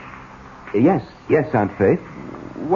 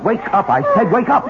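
shouting; 11 semitones higher than usual. Acted. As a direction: bark it at her. Shaking her awake.

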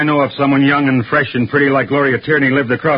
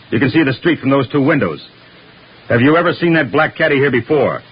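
usual speaking voice

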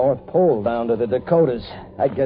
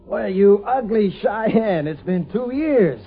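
affectionate teasing; 10 semitones higher, slower than usual. Acted. Teasing an old friend fondly.

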